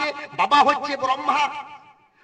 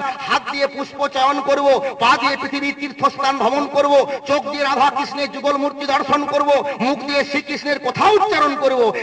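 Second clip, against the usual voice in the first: steady raised chanting recitation; declaiming vow; 4 semitones higher than usual. Acted. Preacher recites souls' promise to crowd, declaiming. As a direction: recite each vow like a litany.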